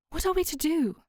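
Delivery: put-on a worried young woman asking for help; Myra, worried. A narrator's reading. Myra voiced, anxious question.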